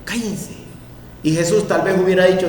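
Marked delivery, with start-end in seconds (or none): 0.0-0.5 whispered shush to the blind men; hushing whisper. Acted. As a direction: hiss them into silence. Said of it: "La gente les decía". (la gente les susurra que se callen)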